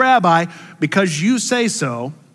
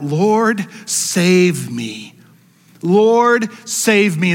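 crying out; 3 semitones higher, 3 dB louder, much slower than usual. Acted. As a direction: cry for rescue, rising and louder. Peter crying out while sinking.

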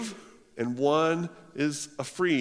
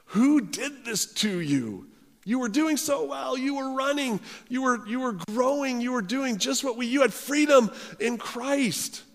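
exasperated Paul; 10 semitones higher than usual. Retold or imitated. Paul lamenting the Galatians gave up their freedom, exasperated. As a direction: cry out in disbelief at their fall. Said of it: "That's how exasperated he is".

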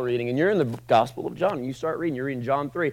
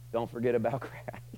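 ordinary delivery

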